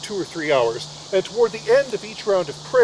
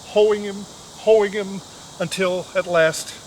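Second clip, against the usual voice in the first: usual speaking voice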